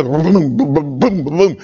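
low, steady booming beat, said with the mouth; imitated bass thump.

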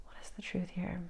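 soft unvoiced whisper, asked inward; hushed whisper, turned inward; whispered question. Real, not acted. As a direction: breathe the question to yourself. Quietly asking her guides inwardly.

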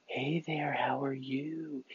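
slow, drawn-out whisper with a little something in it. Shown as a demonstration. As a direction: stretch the whisper out, play with it. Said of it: "No, it could be like".

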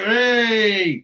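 loud, high-pitched mock cheer; big celebratory whoop, acted out; happy group cheer. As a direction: imitate a delighted crowd cheering. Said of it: "they're all really happy".

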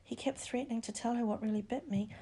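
soft whispered complaint. A narrator's reading. Bedridden boy tells friends of his bite, whispering. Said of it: "he whispered".

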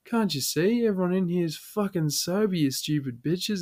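put-on high, excited drunken yelling; drunk rant. Drunk man ranting at officers, high and worked up. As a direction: rant at the cops, half yelling.

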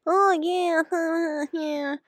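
high, put-on coworker small-talk voice; mocking coworker voice. Mocking her partner's coworkers' chatter, high put-on voice. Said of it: "they do this little talk".

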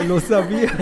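the brother cheers that he knew all along; excited shout. Retold or imitated. Brother hearing the news, lo sabía shouted with a laugh. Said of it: "Así todo emocionado".

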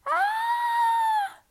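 high piercing scream. Acted. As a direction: shriek in terror. Dream ends in scream.

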